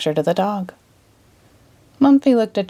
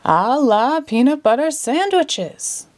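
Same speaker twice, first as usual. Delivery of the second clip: higher, slow sing-song spell; magic incantation; 7 semitones higher, slower than usual. A narrator's reading. Magician pulling a rabbit, slow grand spell. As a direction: draw out the magic words. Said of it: "magic words spell".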